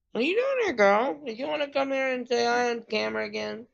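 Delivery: high-pitched cooing to a pet; pet talk. Real, not acted. Streamer asks visiting pet to say hi on camera, pet talk. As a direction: coo questions at the animal, pitched well up. Talking to a pet beside the player.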